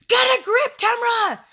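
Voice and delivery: very high, loud, strained scream; yelling at herself. Retold or imitated. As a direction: shriek it as a frantic self-scolding. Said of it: "I'm yelling at myself".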